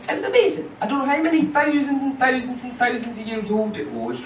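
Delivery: usual speaking voice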